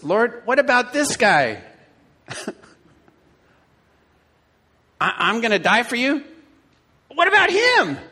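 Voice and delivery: high, loud, whining complaint, rising to a squeal on "him"; whiny Peter. Acted. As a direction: play Peter as a jealous, indignant whiner.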